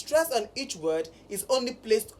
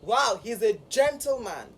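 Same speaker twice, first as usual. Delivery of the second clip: usual speaking voice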